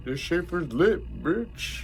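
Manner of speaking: high, slow hype voice; silly party shout. Retold or imitated. Joking about a beer brand, high mock hype. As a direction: drag it out like a goofy hype man. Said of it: "Something about lit".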